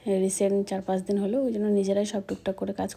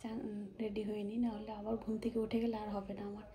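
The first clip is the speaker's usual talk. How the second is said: much softer, hushed whisper; 13 dB quieter than usual. Real, not acted. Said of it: "আস্তে আস্তে কথা বলছি".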